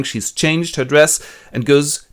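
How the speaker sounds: usual speaking voice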